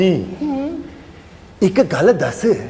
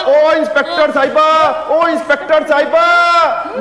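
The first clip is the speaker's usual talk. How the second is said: calling out; 8 semitones higher than usual. Acted. Calling out to the inspector.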